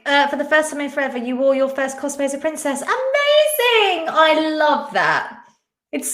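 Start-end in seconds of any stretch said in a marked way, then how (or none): 2.8-5.4 high, drawn-out gush; thrilled, slow and high-pitched; delighted praise. Real, not acted. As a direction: gush with delight, stretching the words. Thrilled at viewer's first cosplay.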